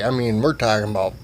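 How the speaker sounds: ordinary talk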